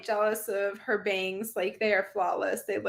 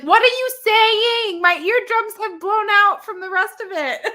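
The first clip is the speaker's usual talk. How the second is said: high, loud, stretched-out complaint; mock exasperated yell; 10 semitones higher, 8 dB louder than usual. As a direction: yell back like you've been deafened. Acted frustrated reply to whispering.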